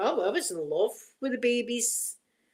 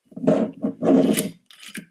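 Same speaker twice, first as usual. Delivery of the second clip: laughter; 9 dB louder than usual. Real, not acted. Laughing at the Bacardi story.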